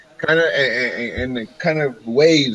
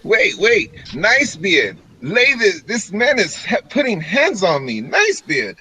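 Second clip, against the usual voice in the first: high-pitched acted protest; flustered protest; 6 semitones higher than usual. Elf being pushed towards the door, flustered protest. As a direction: object in a high, pleading voice.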